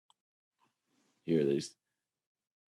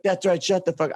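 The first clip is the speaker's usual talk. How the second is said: mock host; 9 semitones higher than usual. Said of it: "He's like".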